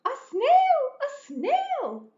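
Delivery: high, loud panicked shriek; the teacher horrified at the snail. Acted.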